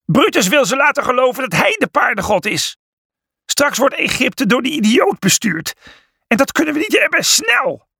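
high-pitched, agitated shouting; urgent alarm. A narrator's reading. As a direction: rouse the others to hurry. Alarm during the race for the top. (hoog en dringend roepen)

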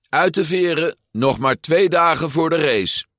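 high, loud wake-up call; girl shouting through the window. A narrator's reading. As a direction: call them out of bed from the window. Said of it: "hem riep vanuit het raam".

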